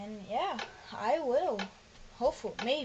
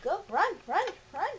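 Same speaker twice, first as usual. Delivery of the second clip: high, urgent run calls; being chased; 7 semitones higher than usual. Real, not acted. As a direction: urge them to flee fast. Urging friend to flee.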